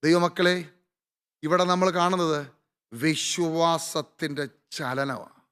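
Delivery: ordinary talk, nothing marked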